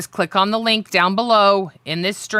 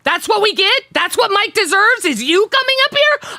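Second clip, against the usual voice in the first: real anger building, each question higher; getting pissed; 9 semitones higher than usual. Real, not acted. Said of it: "now I'm getting pissed".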